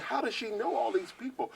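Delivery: put-on awed young fan wondering aloud; amazed. Amazed by Natalie's music knowledge.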